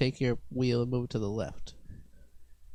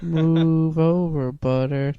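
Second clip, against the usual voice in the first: slow, louder ad announcer read; TV commercial voice; 3 semitones higher, 6 dB louder, much slower than usual. Retold or imitated. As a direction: stretch it out like a cheesy butter ad. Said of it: "like, commercial".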